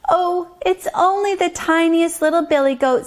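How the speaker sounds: little goat's high storybook voice; very small voice. A narrator's reading. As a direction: speak up high as the littlest goat. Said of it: "in his very small voice".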